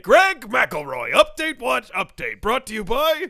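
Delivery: very loud, high jingle echo; mock sponsor announcer. Co-host echoing Greg McElroy's segment title, mock announcer. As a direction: blast out the title and sponsor lead-in.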